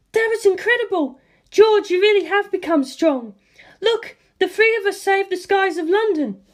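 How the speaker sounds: put-on high, bright girlish excitement; a young woman thrilled after the win; excited Lisa Lisa. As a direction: gush with pride.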